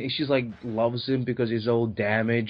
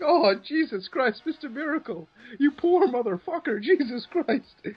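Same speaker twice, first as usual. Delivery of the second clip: high, wailing sympathy; hammed-up, high-pitched commiseration; mock pity; 12 semitones higher than usual. Pitying Mister Miracle, laughingly.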